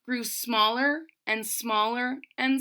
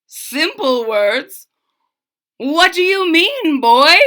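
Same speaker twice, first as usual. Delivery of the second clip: louder, rising puzzled king; 5 semitones higher, 9 dB louder than usual. A narrator's reading. King questioning the bold boy, puzzled. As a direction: demand an answer like a baffled king.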